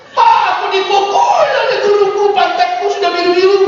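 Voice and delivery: put-on whiny kid complaining about a beaten bottom; mock complaining child. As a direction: tattle to Dad in a kid's voice.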